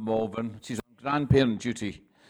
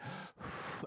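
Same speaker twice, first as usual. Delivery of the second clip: audible breath out through the mouth; exhaling; 15 dB quieter than usual. Shown as a demonstration. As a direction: blow the air out, demonstrating breathing. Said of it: "out his mouth".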